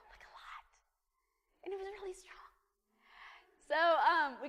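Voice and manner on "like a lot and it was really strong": very soft, whispered aside; confiding whisper. Real, not acted. Speaker admitting to too much coffee, whispered aside. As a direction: whisper it like a confession. Hushed confession about morning coffee.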